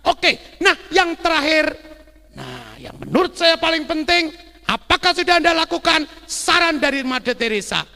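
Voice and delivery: high, loud sermon exhortation; full-force preacher; emphatic preaching. Real, not acted. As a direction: drive the point home to the congregation.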